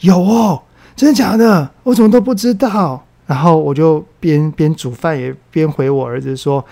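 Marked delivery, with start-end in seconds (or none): 0.0-3.1 a higher, louder gasp of disbelief; imitating a son caught off guard, amazed; mock astonished kid, higher and louder; surprised son. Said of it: "就很惊讶的就回说".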